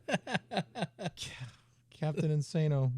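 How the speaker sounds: ordinary talk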